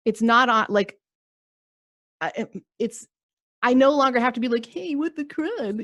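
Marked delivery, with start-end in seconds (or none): 4.7-5.9 high, slow mock outrage on "what the crud"; mock-offended Karen voice. Karen no longer needing to take offence, high and slow in mock outrage. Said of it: "I say that in that voice".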